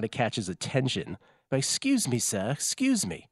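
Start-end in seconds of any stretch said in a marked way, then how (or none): 1.6-2.2 high, polite mock call of "Excuse me". Polite imitated tap on shoulder. 2.2-3.3 slower polite repeat. Retold or imitated. Polite imitated tap on shoulder.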